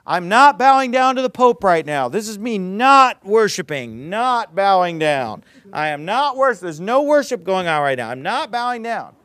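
loud, mocking protest that this is not worship; mock insistent denial.

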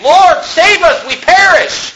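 high, drawn-out cry for help; hammed-up terrified wail; panicked plea. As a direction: cry out like a desperate disciple in a storm. Disciples crying out in the storm.

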